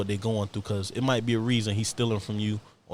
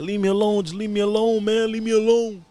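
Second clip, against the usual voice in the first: mock tearful pleading, higher and louder; imitating crying plea; 11 semitones higher, 6 dB louder than usual. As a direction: retell a scared man's tearful begging. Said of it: "it sounded like he was crying".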